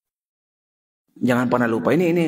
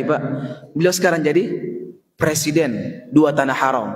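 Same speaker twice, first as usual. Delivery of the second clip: ordinary talk, nothing marked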